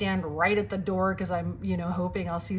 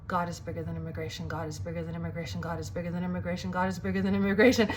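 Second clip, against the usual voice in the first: low, hushed, fast repetition like a mantra; rapid muttered mantra; 4 semitones lower, 5 dB quieter, faster than usual. Retold or imitated. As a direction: chant it to yourself quickly, low and under your breath. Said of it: "over and over, like a mantra".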